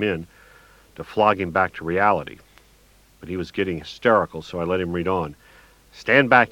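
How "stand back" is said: louder, higher mock yell. A narrator's reading. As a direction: bark a warning to stand back. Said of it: "he yelled".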